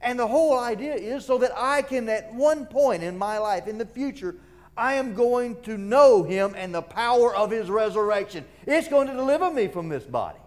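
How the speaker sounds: high, loud preacher's proclamation; fervent preaching. Acted. Preacher voicing Paul's hope of resurrection, fervent and high. Preaches as Paul at full force.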